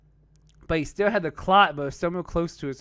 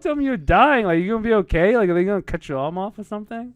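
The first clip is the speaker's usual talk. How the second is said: retold alarm; 6 semitones higher, 5 dB louder than usual. Retelling alarmed questions to his friend.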